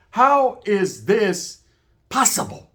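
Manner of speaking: slow, drawn-out disbelief, fading to a whisper on possible; incredulous disbelief. Retold or imitated. To the homie who claims he's someone else, slow stunned disbelief. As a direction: stretch it out like you can't believe your ears. Confronting Leo who denies himself.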